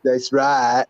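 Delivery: Forrest Gump impression. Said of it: "Life is like a box of chocolates".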